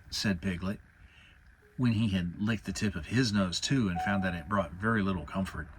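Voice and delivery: ordinary delivery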